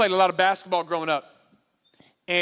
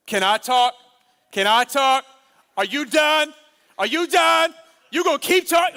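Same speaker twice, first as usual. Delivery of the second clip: rising louder and higher with each question; impatient, cutting in, getting more worked up; heated argument; 6 semitones higher, 6 dB louder than usual. Retold or imitated. Husband arguing with his wife to be heard, building annoyance.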